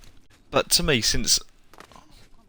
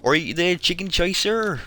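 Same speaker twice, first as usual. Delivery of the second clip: higher, louder Bristolian yokel call; Bristolian farmer accent; 9 semitones higher, 4 dB louder than usual. Retold or imitated. Gamer quoting a Fable villager, hammy Bristolian accent. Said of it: "He's a right farmer's accent".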